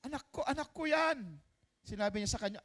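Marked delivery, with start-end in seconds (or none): 0.1-1.4 crying mother. Acted. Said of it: "umiiyak po siya".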